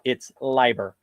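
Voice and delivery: "liber" said as lee-ber, correcting the mispronounced "Lieber"; a light correction, "liber" with a long ee; correcting the name. Retold or imitated. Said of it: "And he goes, "First of all".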